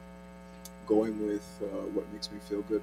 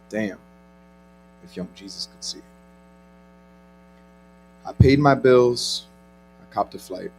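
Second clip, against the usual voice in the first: spoken word poetry; 13 dB louder than usual. Acted. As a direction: perform it like a slam poet. Performs spoken word poem.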